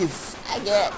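normal talking voice

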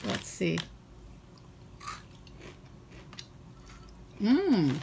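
tasting and describing food; talking while chewing. Real, not acted. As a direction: mouth partly full of snack. Seen 3: Hand-to-mouth eating just before; lips pressed, chewing at start.

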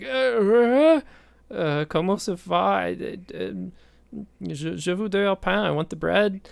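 high, flustered stammer in broken French; nervous panic. Retold or imitated. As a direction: squeak it out, lost and anxious. Panicked self asking for bread.

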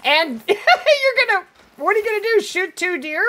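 high, laughing incredulous; laughing disbelief. Real, not acted. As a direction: laugh at the idea.

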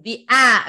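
آ held long and open; long aa vowel. Shown as a demonstration. Teacher showing pupils the long آ sound. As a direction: stretch the آ, a long aa. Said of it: "المد كده في الريدنج بتاعك".

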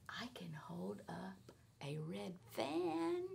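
secretive whisper. Acted. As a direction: let it out like a magic secret. Cat reveals tail trick.

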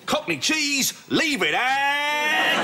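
high slogan call with the last word drawn right out; slogan pitch. Acted. Copywriter unveiling his Cockney cheese slogan, high drawn-out call.

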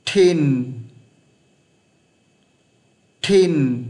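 "Thin" with airy th, long and falling; slow model "Thin" for repeating; correct thin. Shown as a demonstration. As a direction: hold the n of "Thin" as it drops. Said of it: "Không phải là tin đâu nhá".

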